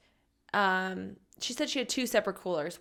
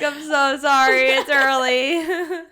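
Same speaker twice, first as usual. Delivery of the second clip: loud, high, drawn-out apology; retold flustered apology; 4 semitones higher, 9 dB louder, slower than usual. Woman apologising to car owner, hammed-up flustered wail. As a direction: wail the apology in mock mortification.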